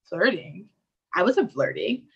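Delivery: mock-innocent denial, lightly voiced; Brittany denying. Friend denies flirting to her friends, mild mock-innocent voice. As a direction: play dumb about the flirting. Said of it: "So this is my imitation".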